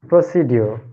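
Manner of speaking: "procedure" said as pro-si-dyo; old mispronunciation. Shown as a demonstration. Student shows teacher how they used to say it, mispronounced. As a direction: end "procedure" in dyo, with no j sound. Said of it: "I used to, mm, pronoun-, pronounce it".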